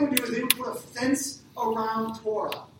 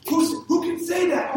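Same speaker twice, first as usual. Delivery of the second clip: 1 indignant outcry; 7 semitones higher, 6 dB louder than usual. Retold or imitated. Crowd objects to forgiving sins.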